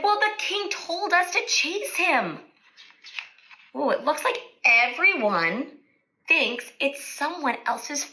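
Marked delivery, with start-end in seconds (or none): 0.0-2.4 knights explaining. Acted. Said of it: "the knights said".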